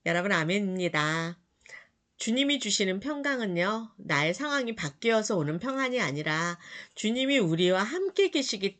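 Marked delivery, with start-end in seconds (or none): none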